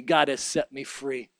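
ordinary delivery

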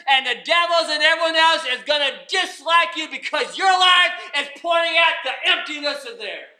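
full-force preaching. Real, not acted. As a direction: cry out the psalm to the congregation.

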